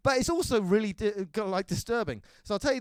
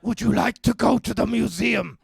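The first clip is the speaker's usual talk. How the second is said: Batman; 3 semitones lower than usual. Acted. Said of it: "Batman is a man in full body armor going up to people".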